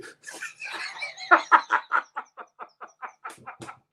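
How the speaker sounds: high, breathless wheezing laugh; helpless laughter. Real, not acted. Laughing so hard at grandmama's line she nearly passes out. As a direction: laugh until you can barely breathe. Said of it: "I'm about to pass out".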